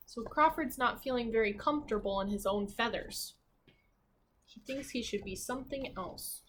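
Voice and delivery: usual speaking voice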